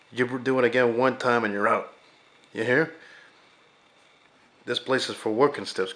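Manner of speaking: loud, scolding junkyard boss laying down the rules; angry boss rant. Retold or imitated. Darnell yells his garage rules at Arnie, loud and angry. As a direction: chew him out and lay down the law. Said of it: "to, to Arnie and he says".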